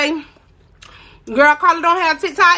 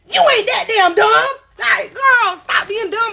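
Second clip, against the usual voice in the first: exasperated mock shout; 7 semitones higher than usual. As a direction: tell her off, half laughing at how dumb it is. Exasperated retort about mom.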